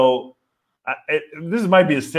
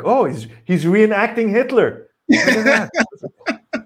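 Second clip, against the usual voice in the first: higher, very slow, laughing tourist voice; mock gawking tourist; 6 semitones higher, slower than usual. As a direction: gawk like an amused tourist, drawing it out. Tourist voiced watching angry German.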